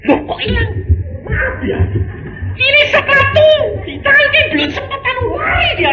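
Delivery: high, loud flustered cry of a husband whose shoes are gone; mock panic. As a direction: act him scrambling in alarm, high and loud.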